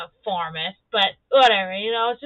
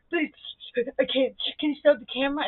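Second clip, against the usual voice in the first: mock sobbing plea; 3 semitones higher than usual. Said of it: "you guys sit here and be like".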